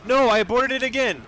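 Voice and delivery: high, loud exasperated cry; frustrated shout. Real, not acted. Speedrunner yelling at another aborted refinery recon, furious shout. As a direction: yell it out as the run falls apart again. Speedrunner fails the objective again.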